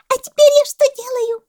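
very high, loud call; monkey shouting. A narrator's reading. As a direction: call down from the palm, squeaky. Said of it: "закричала мартышка с пальмы". (мартышка кричит с пальмы, пронзительно)

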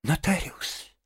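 high, hurried alarm; frightened muttering. A narrator's reading. Rat hurrying off for the notary, muttering in fright. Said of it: "испуганно бормотал Крысси".

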